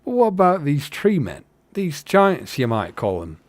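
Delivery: accidental Irish lilt for a hobbit; lilting Irish storyteller, starting high and rising in emphasis; Irish accent. Acted. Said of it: "Now I sound Irish".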